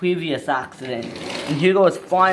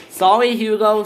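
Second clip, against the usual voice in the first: raised, higher voice calling back; shouted apology; 5 semitones higher, 5 dB louder than usual. Acted. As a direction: call the apology across, loudly. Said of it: "shouted Thomas".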